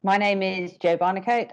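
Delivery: ordinary delivery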